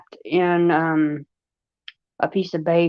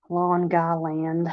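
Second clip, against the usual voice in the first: slow, drawn-out Long Island accent, "Lawn guy land"; 5 dB quieter, slower than usual. Shown as a demonstration. Showing stream viewers her Long Island accent, slow "Lawn guy land". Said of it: "I got a mad long guy accent".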